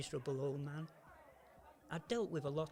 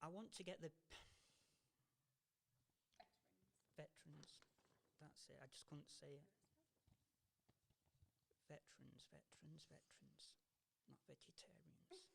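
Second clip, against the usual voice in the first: muttering; 21 dB quieter than usual. Real, not acted. Muttering after fluffed line.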